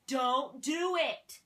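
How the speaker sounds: loud, drawn-out mock PSA warning; emphatic warning. Speaker giving a PSA on negative energy, emphatic warning. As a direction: stretch each word into a firm, loud warning.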